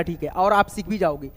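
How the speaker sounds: ordinary talk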